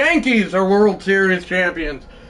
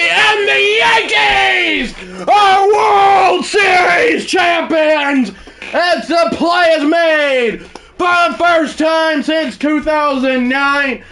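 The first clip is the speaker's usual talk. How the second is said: triumphant shout; 8 semitones higher, slower than usual. Pretend. Streamer calling the Yankees' title win, shouting in triumph. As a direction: belt it out like the final-out call. Championship-winning announcer-style call.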